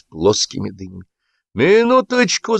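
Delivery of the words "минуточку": very high, drawn-out call; shouting after someone. A narrator's reading. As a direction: call out to stop someone leaving. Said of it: "закричал вдогонку Сапфиров".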